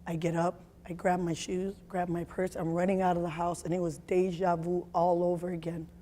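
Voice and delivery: ordinary talk, nothing marked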